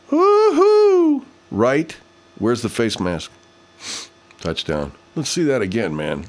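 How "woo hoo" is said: very high, loud woo-hoo; whooping cheer. Real, not acted. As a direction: let out a high celebratory whoop. Cheering during the game.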